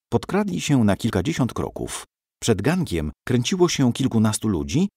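plain reading voice

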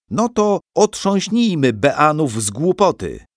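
rousing proclamation. A narrator's reading. Ceremony master rouses the students, high, loud. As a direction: fire up the crowd.

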